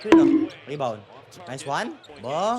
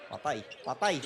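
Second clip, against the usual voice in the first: ordinary talk, nothing marked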